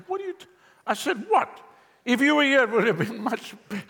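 high, loud shocked "What?"; shocked disbelief. Retold or imitated. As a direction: blurt it out in stunned disbelief. Retold shock at the Lord's answer.

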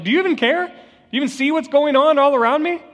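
high, pleading cry of despair; desperate plea. Acted. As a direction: beg as if drowning and ignored. Disciples crying out in storm.

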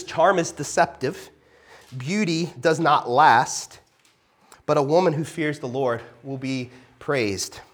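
slow, weighty reading of the verse; preacher reading Proverbs aloud to the congregation; scripture reading. Acted. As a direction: read it out slowly and solemnly.

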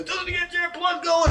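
drill sergeant shout. Retold or imitated. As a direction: pump him up like a recruiter. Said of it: "He goes".

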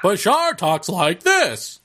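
slow, stretched-out, high-pitched impression; Bashar. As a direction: put on an odd voice, drawing each word out. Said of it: "He sounded like Bashar".

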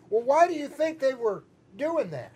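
ordinary delivery

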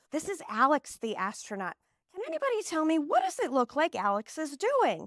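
put-on high, sing-song question to little kids; preschool teacher voice. Shown as a demonstration. Teacher showing the astronaut card to her class, high sing-song. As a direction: coax the class to guess. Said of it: "I'm gonna go".

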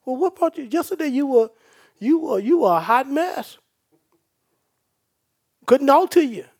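loud, high-pitched preacher calling out; fiery preaching. Acted. Preacher teasing the congregation about yesterday, fiery and loud.

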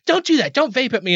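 high-pitched mock scolding; high, insistent complaint, acted out; scolding woman. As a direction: imitate a fussy woman objecting.

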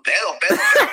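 loud laughing shout; excited shout. Real, not acted. Joking about being drunk.

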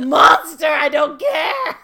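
loud, high, slow yell as if crying out; mock furious shout. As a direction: scream it at the villain, half crying. Outraged at the character.

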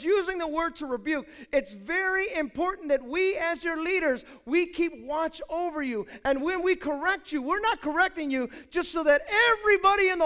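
loud, high preaching voice, building; preaching at full force. Acted. Pastor preaching to his church, loud and driving. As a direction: preach it hard to the congregation.

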